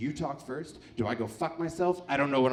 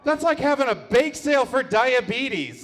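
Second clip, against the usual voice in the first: loud, higher punchline; louder, higher punch on diabetes; 8 semitones higher, 8 dB louder than usual. Real, not acted. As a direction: hit the joke big and loud. Punchline delivered loudly.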